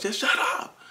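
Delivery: very high-pitched mock snap; retold shutting-up outburst. Working dad to crying baby, exasperated high-pitched retort. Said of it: "had to go shut him up".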